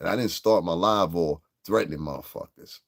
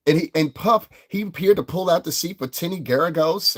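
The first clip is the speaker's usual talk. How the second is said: higher mocking imitation of her; imitating her; 9 semitones higher, 3 dB louder than usual. Streamer quoting the rival host's live, mocking higher voice. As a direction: parrot her claim mockingly. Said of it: "she said".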